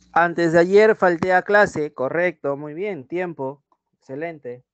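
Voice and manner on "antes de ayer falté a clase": dictado lento y claro, en voz alta; lectura de oración. Shown as a demonstration. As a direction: lee la frase despacio para la clase. (lectura pausada de dictado)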